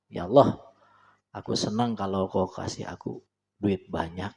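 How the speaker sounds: plain, quietly confident asking; calm steady prayer. Shown as a demonstration. Preacher demonstrating the right calm prayer to God. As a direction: pray simply but firmly. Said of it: "biasa aja, tapi mantep".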